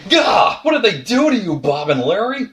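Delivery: high, loud mock-horror shriek; hammed-up scream of dismay; horrified outcry. Mock horror at ruined franchise characters.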